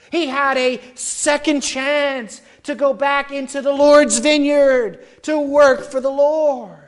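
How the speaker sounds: loud, high-pitched sermon climax; preaching at full force. Acted. Pastor urging the congregation back to the vineyard, preaching loud.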